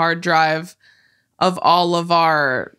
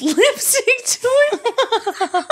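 laughing; much slower than usual. Real, not acted. Laughing while telling a story.

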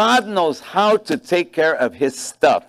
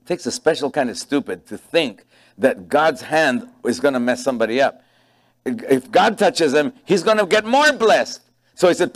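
ordinary talk

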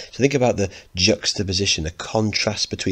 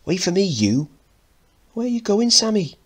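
calling out; 13 semitones higher, 3 dB louder than usual. Acted. Mickey and mum calling.

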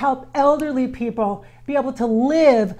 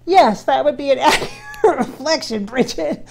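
amused, cracking up mid-sentence; laughing answer; 3 semitones higher, 6 dB louder than usual. Retold or imitated. Relaying his laughing agreement to Bridget, laughing. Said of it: "He kind of laughs at me".

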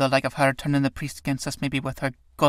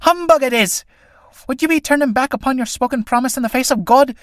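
high and loud, indignant; angry woman holding the priest to his promise; angry retort; 10 semitones higher, 4 dB louder than usual. Acted. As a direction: throw his word back at him. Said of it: "with anger still in her voice".

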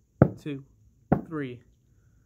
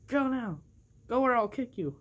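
bossy toy character; 7 semitones higher than usual. Pretend. Toy figure bossing another figure.

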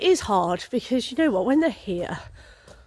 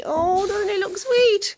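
cooing; 10 semitones higher, slower than usual. Real, not acted. Admiring the horse's new brush.

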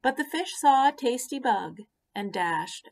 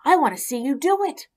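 excitedly; 7 semitones higher, 8 dB louder, faster than usual. Acted. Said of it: "the pollywog said excitedly".

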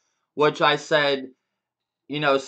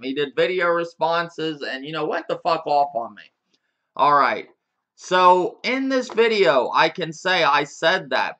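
usual speaking voice

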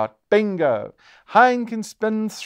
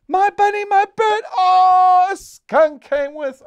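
higher and louder, building to a loud cry on oh; real game excitement rising into a shout, then a groan as the skunk appears; excited dismay; 7 dB louder, slower than usual. Real, not acted. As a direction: burst out as the skunk ruins the win. Near win foiled by skunk.

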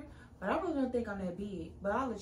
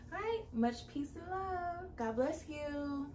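slow warm sign-off; 7 semitones higher, slower than usual. Real, not acted. Sign-off blessing.

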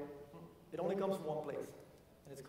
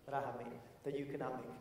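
quiet repeat; 6 semitones lower, 8 dB quieter, faster than usual. Real, not acted.

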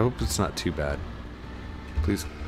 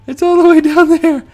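much higher and louder alarmed cry; high, loud real panic; panicked no; 8 dB louder, slower than usual. Real, not acted. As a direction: shriek as the sled slips away. Sled slides away, panic.

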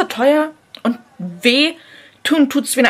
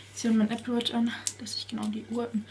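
soft whispered commentary; 10 dB quieter than usual. Real, not acted. Vlogger whispering while putting on the watch. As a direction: whisper while getting ready.

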